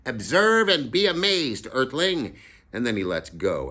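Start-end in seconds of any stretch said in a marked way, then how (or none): 0.1-2.3 much higher, louder, slow proclamation; grand announcement. Acted. Freak shows off his bird to the Earthling, much higher. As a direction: announce it like a showman alien. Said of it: "and he goes".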